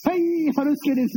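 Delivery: high, slow, drawn-out phone greeting impression; high-pitched drawn-out hello; 春助師匠. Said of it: "春助師匠".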